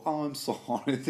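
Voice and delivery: a light, rising "oh, I'm sorry"; mock apologetic. Podcaster imitating the sorrowful assassin's apology. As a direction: toss off a fake apology. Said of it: "I bet that was his tone".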